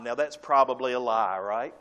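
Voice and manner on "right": usual speaking voice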